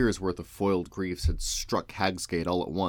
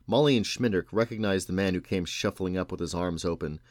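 ordinary delivery, nothing marked

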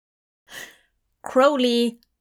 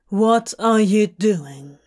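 slow, deadpan and weary; tired, flat Crowley; 4 dB louder, slower than usual. Acted. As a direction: ask it flatly, with no interest at all. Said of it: "in the same flat voice he's had".

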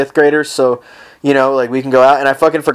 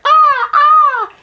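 shrill, very high squawking cries like a gull; mock gull squawk yelling for Mom; seagull screech; much slower than usual. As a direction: screech it out like a seagull stealing food. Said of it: "You're gonna hear".

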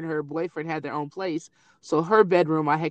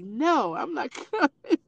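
stubborn daughter; 8 semitones higher, slower than usual. Retold or imitated. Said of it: "She's like".

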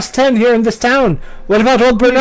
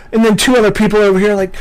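normal talking voice

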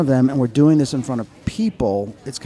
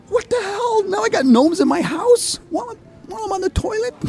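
shocked disbelief; 3 dB louder than usual. Retold or imitated. Retold shock at gnome.

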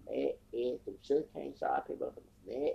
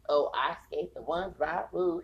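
mocking voice; 6 semitones higher, 6 dB louder than usual. Mocking them in their voice.

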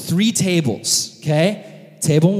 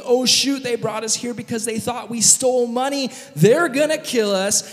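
high-pitched acted worry; anxious, higher-voiced fretting; freaked out; 6 semitones higher than usual. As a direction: spiral into mock panic. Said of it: "the brothers are freaked out".

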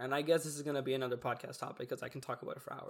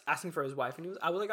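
usual speaking voice

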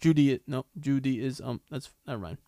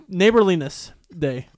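normal talking voice

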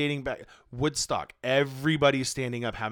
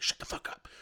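hushed, stern shut-down; whispered hiss; 7 dB quieter than usual. Retold or imitated. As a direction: whisper it sharply to shush her. Husband silencing his wife.

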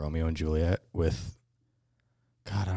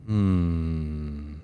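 normal talking voice

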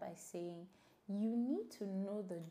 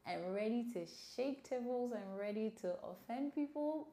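grinning, playfully raised pitch; smiling voice; 4 semitones higher than usual. Real, not acted. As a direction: say it with a big grin. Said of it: "I'm sure you can hear the smile in my voice".

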